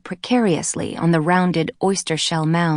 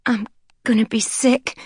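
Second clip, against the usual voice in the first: nauseated gasp; 5 semitones higher than usual. A narrator's reading. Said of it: "the young woman managed".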